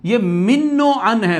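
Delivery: मिन्नो with a clear "i", doubled n, said slowly; correct form, slowly. Shown as a demonstration. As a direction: stress मिन्नो, slow and clear. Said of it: "मन्नो अन कह रहे होते हैं".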